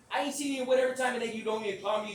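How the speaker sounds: high, loud, hurried protest; retold complaint. Speaker complaining Sweet never calls, loud and indignant. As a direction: rattle off the grievance loudly.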